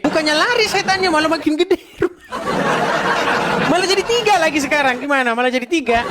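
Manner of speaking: high, quick, laughing incredulity; mocking laughter. Real, not acted. Menertawakan lempar setan yang makin besar. (tertawa geli mengejek)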